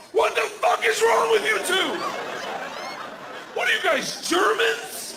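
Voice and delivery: high outraged dad yell; incredulous yell. Retold or imitated. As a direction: yell at the kids in disbelief. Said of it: "I was like".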